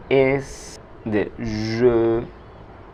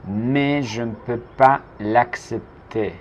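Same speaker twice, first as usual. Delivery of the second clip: slow and deliberate; slow model; slower than usual. Shown as a demonstration. Teacher modelling the sentence slowly for the class. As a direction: say it slowly for learners to copy. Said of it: "teacher models slowly then repeats".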